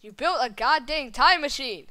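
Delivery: loud exasperated yell, slowing down; exasperated shout. Acted. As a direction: yell in disbelief.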